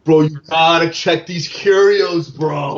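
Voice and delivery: slow, drawn-out bro voice; frat bro. Acted. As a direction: lay on the dude-bro swagger.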